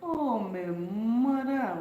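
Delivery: very slow, drawn-out dialect "Oh, my mother"; Caribbean dialect, OH stretched with a falling tune; dialect exclamation. Shown as a demonstration. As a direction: draw out "Oh, my mother" like an island aunt. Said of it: "Oh, my mother is one for real".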